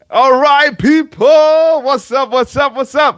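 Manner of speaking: high, loud call-out to the chat; hyped greeting. Real, not acted. Streamer greeting his live chat, calling out high and loud. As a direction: fire up the crowd with a big hello.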